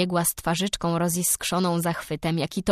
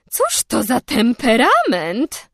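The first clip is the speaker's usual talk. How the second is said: astonished exclamation; 4 semitones higher, 7 dB louder, slower than usual. A narrator's reading. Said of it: "wykrzyknęła osłupiała".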